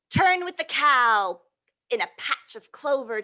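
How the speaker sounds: ordinary delivery, nothing marked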